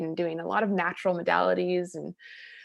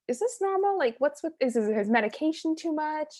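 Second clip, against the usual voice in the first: 1 worried asking; 8 semitones higher than usual. Retold or imitated. Worried question to grief nurse.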